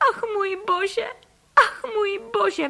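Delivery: high, theatrical tearful wail; sobbing. A narrator's reading. Wife losing her house to ducks, sobbing. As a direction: wail it in drawn-out sobs.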